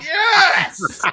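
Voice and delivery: very high, laughing cry of triumph; triumphant glee. Retold or imitated. Fan delighted the star insulted him, triumphant glee. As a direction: squeal it as if you just won.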